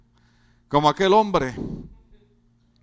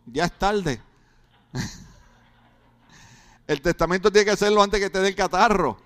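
ordinary talk, nothing marked

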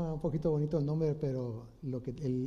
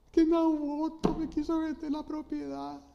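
whiny tantrum; 13 semitones higher than usual. Retold or imitated. King Ahab sulking on his bed, high childish whining tantrum. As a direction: sulk and wail like a child denied a toy. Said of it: "hacer berrinche".

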